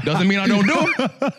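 cracking up on the repeat; laughing. Real, not acted.

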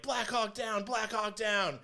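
high urgent mock military call; high hammed-up distress call; mock radio call. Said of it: "started going into".

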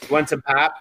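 a touch of Minnesotan accent; lightly put-on Upper Midwest voice. Shown as a demonstration. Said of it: "Minnesota, eh?".

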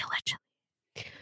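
breathy whispered aside. Pretend. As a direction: whisper it like a secret. Joking disclaimer whispered.

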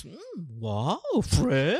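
mock dreamy wow; impressed girls. Host mimics girls hearing French boyfriend, mock swooning. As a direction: lightly imitate swooning girls. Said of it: "you know, my French boyfriend".

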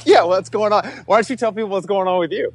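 high, fast radio host voice; announcer voice. Acted. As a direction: play the chirpy show host. Podcast host intro voice.

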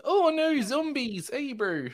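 put-on very high, jokey Australian accent; mock Australian accent. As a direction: act scared in a silly Australian accent. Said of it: "none of the accents allow you to be scared".

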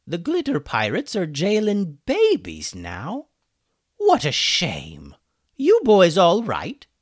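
put-on a fussy old chicken calling across the hall; chicken wizard. Hummus calling to the boys from his cell.

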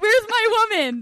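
lightly voiced lovesick captain; mock hero. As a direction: play the desperate hero searching. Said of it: "And he's like".

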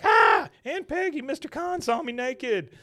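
high, loud shrieking cry; shrieking girl. Retold or imitated. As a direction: shriek it out, scandalized. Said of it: "And she's like".

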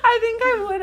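very high, squeaky with laughter; real helpless laughter. Real, not acted. Telling friend how she would react, squeaking through laughter. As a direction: try to talk while cracking up. Too amused to get words out.

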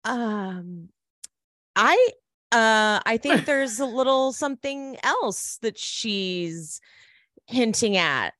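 halting, drawn out, lifting in pitch; mock awkward hesitation. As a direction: hedge coyly with long pauses. Acting actress hesitating awkwardly before explaining.

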